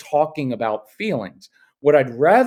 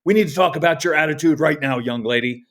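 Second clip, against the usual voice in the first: mock stern lecture; faster than usual. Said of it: "It escalates".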